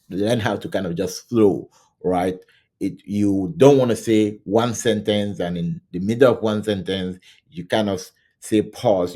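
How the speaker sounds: usual speaking voice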